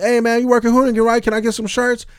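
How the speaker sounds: high, loud overfriendly greeting; butter him up cheerfully; high, loud fake-friendly greeting; fake-friendly guy. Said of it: "then he'd be like".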